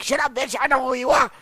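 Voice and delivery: cocky yelled reply; loud comeback. Retold or imitated. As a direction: shout her down. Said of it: "And I'm like".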